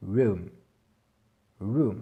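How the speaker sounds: room with a vowel between ou and e; room, ou blended toward e; room, son entre ou et e; English room. Shown as a demonstration. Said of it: "C'est un mix entre le ou et le e".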